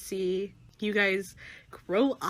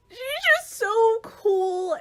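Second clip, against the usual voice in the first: high squeal; 14 semitones higher, 10 dB louder, slower than usual. Acted.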